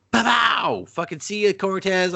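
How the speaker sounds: very high, hammed-up send-off; mock gleeful kill. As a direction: pretend to shoot, crowing high. Acted villain shooting a cop.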